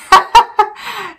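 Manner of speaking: high burst of laughter; real giggling laugh. Real, not acted. Laughs at own remark.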